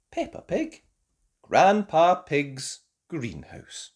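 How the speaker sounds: usual speaking voice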